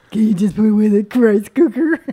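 a light lumbering hunchback voice; mock hunchback voice. Teasing her husband about the rice, a lightly hunched mock voice. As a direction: drag it out like a clumsy hunchback. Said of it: "like a fucking hunchback".

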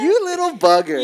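high, loud mock scolding; playful scolding. Teasing co-host who interrupts, high mock scolding. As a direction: tease in a high put-on voice. Playful name-calling between co-hosts.

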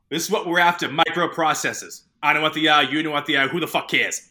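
mock boss rallying his team; Baldwin impression. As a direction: bark it like a swaggering boss. Acting out Baldwin's raid speech.

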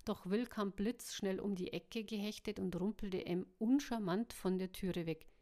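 usual speaking voice

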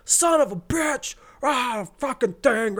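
high, strained mock cursing; pained cursing. Acting out a stubbed toe by the bed, high pained cursing. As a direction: curse through a stubbed toe.

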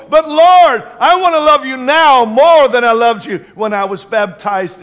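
high, loud, full-force prayer; impassioned preaching. Real, not acted. Preacher leading the congregation in prayer, impassioned and loud. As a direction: cry out to God with full fervor.

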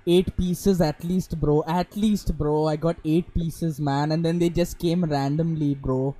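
hammed-up Indian accent, loud. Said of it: "I think I nailed the Indian accent".

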